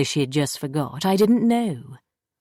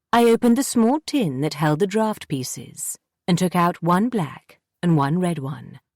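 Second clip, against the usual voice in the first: plain reading voice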